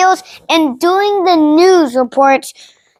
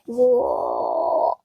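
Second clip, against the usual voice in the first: deep whoa; 8 dB quieter than usual. Pretend. Mock dramatic pause.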